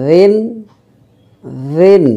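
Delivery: রিন as soft "dhin"; light, thin dh in রিন; soft voiced TH. Shown as a demonstration. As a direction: start রিন gently, th as in "this". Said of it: "নরম করে".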